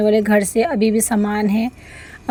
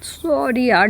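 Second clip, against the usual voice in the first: talking through a yawn; 3 semitones higher, slower than usual. Real, not acted. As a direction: yawn while apologising. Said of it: "जम्हाई आ रहा है".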